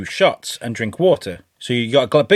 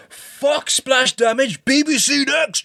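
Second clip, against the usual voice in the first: high, drawn-out pretend yell; mock scream at fans; 14 semitones higher, much slower than usual. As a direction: scream it out slowly like a raving DJ. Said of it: "scream at your fans".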